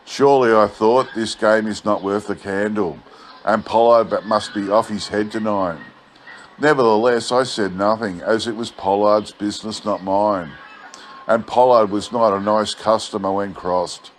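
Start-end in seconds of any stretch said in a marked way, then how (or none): none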